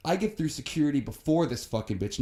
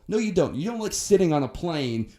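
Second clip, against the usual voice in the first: emphatic retort; 6 semitones higher, 4 dB louder than usual. Real, not acted. Rant retort to rich kids.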